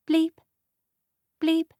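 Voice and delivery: high clipped bleep, twice; high electronic beep; machine bleep. A narrator's reading. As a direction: mimic a hospital monitor. Machine noise imitated.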